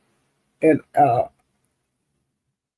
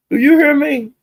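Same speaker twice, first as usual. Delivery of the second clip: excited; 10 semitones higher, 3 dB louder than usual. Real, not acted. Gossip punchline to the chat.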